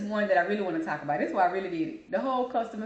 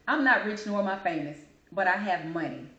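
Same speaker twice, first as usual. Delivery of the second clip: usual speaking voice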